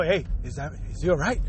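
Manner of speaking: low, soft aside; overheard concerned friends. Acted. Friends outside the bathroom asking about him, hushed concern. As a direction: ask it hushed, as if from outside the door. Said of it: "and you hear people".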